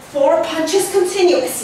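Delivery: ordinary talk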